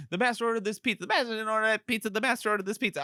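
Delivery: high, rapid mock squabble; cartoonish high-pitched bickering; bickering back-and-forth. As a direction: rattle off both sides of the argument. Acting out rapid back-and-forth argument.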